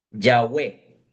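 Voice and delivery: Yahweh drawn out low as YAH-weh; slow Yahweh demo. Shown as a demonstration. Teacher repeating Yahweh low so the class learns it. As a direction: model Yahweh slowly for students. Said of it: "se pronunciaría como Yahweh".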